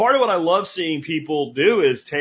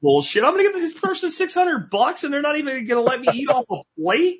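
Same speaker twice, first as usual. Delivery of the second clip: outraged, pitched up high on the complaint; incredulous rant, voice climbing higher; indignant disbelief; 10 semitones higher than usual. Retold or imitated. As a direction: scoff at the idea of paying that much and eating off no plate. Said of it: "I'm like".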